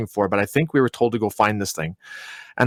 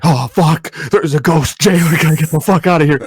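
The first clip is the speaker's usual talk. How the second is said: high, loud panicked yell; panicked exclamation; 5 semitones higher, 5 dB louder than usual. Retold or imitated. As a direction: act out bolting in terror from a ghost. Acting out seeing the ghost.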